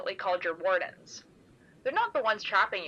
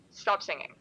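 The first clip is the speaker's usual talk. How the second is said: ordinary delivery, nothing marked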